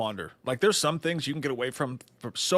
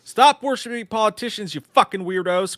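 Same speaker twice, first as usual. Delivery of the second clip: loud read-out quote; 9 semitones higher, 7 dB louder than usual. Retold or imitated. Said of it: "read-out chat quote".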